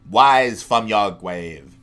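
slow, drawn-out game wizard with no R sound; Altered Beast wizard. Retold or imitated. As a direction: intone it slowly like an old arcade voice. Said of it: "It's from Altered Beast".

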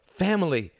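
high, sharp burst; insistent cry. Acted. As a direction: fire the word back. Tom insists loudly.

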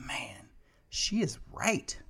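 slow, hushed concession; half-whispered, drawn-out realization; awed whisper. Retold or imitated. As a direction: whisper it slowly, won over by the argument. Stunned admission after opponent's argument.

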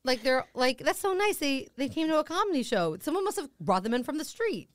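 high, cooing wonder; naive delight. Retold or imitated. Comic spotting navy men in the audience, naive delight.

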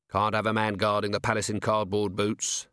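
usual speaking voice

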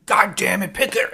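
high, exasperated mock yell; retold angry scream. Doctor snaps at a nurse over surgery, mock scream. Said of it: "screaming at her".

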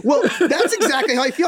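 said high through laughter; laughing. Real, not acted. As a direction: still laughing at the joke. Laughing after friend's impression.

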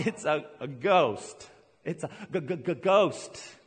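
stammering, slowed mock fright over the ghost; mock scared stammer. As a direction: act the frightened disciples, stuttering slowly. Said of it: "they cried out".

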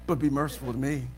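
sly aside. Retold or imitated. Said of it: "Don't you get that way?".

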